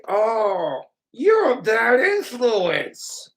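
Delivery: loud, high, drawn-out mock dismay; exaggerated scolding. Friend reproaches smoker who drinks, exaggerated high scolding. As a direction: stretch it out in hammed-up disapproval.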